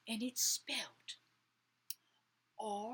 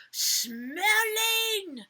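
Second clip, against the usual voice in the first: high, loud, drawn-out call; children crying out; 11 semitones higher, 13 dB louder than usual. A narrator's reading. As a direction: call it out like an excited class. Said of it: "The children cried out".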